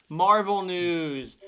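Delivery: slow, loud show-segment announcement; announcer. Acted. Host opening the Marvel news segment, slow and loud. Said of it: "announcing segment".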